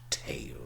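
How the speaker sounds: "tail" with the modern ay diphthong, low and soft. Shown as a demonstration. A lecturer gives the modern pronunciation, tail. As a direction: say "tail" as tayl. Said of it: "and modern English".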